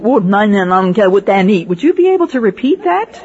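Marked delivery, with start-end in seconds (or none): 0.0-1.7 a run of foreign syllables in one breath; imitating unknown language. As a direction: reel off an unfamiliar language as if native. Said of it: "if somebody says to you".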